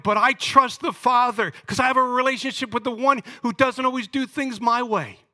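anguished preaching. Real, not acted. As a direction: pour out the loss at full force. Preaching through grief for friend.

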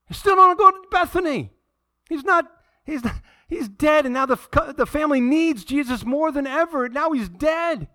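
high-pitched, incredulous outburst; exasperated protest. Acted. Preacher voices outrage that Jesus skips Bethany, exasperated protest. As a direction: protest loudly, pitch climbing way up.

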